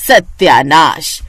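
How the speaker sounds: slow, loud, exasperated curse; drawn-out disgusted groan. Acted. Aunt cursing the maggi, slow and loud in disgust. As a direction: drag out the word in disgust.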